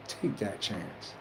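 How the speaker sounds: soft slow encouragement; hushed urging. Real, not acted. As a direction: press it home almost under the breath. Urging listeners, repeated line.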